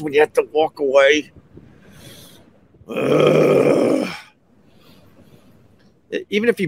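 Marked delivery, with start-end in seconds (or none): 2.9-4.1 long disgusted "ugh"; frustrated groan. Real, not acted. Storyteller regretting he walked away, frustrated groan.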